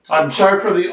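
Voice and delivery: usual speaking voice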